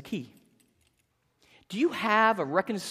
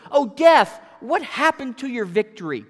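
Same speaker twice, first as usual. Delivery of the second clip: loud taunt; 4 semitones higher, 9 dB louder than usual. Acted. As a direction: jeer at a defeated enemy, full voice. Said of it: "he taunts with these words".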